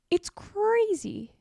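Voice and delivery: high, slow, stretched-out disbelief; drawn-out exasperation. Real, not acted. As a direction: drag out "crazy" in disbelief. Exasperated rant about vendors.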